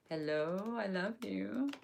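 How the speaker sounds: soft playful hello to herself; mock greeting voice. Teacher reading out her own typed test message, soft coo. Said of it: "that's me saying hello".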